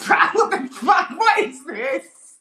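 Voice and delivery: loud, high squeal of laughing disbelief; helpless laughter. Real, not acted. Comedian losing it at his own absurd bit, helpless laughter. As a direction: shriek it through laughter, dragged out slowly.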